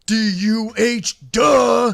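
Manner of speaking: slow, high, loud letter-by-letter DUH; spelled-out taunt. Retold or imitated. Police chief spells out duh to drunk kids, slow and loud. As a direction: drag out each letter of D U H with scorn. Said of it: "and he goes".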